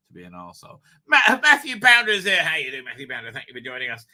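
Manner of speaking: usual speaking voice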